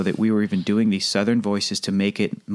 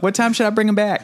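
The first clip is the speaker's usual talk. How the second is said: high, put-on Southern woman's voice; Southern driver; 11 semitones higher than usual. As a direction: ask as the driver character. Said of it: "as the driver, I'm like".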